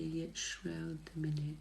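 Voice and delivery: normal talking voice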